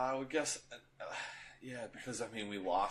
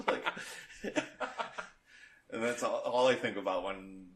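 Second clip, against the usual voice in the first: ordinary delivery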